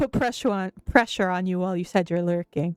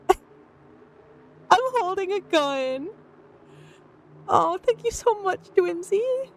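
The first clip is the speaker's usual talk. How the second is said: overjoyed; 9 semitones higher than usual. Real, not acted. Still thrilled by gifted subs.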